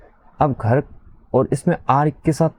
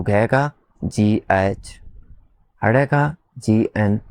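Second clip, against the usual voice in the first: spelled out slowly; slower than usual. Shown as a demonstration. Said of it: "teacher spelling a letter".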